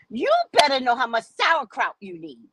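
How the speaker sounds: usual speaking voice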